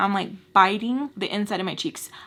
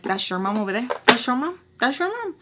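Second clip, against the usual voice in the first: mocking taunt; 4 semitones higher, 3 dB louder, faster than usual. Taunting him about his mom.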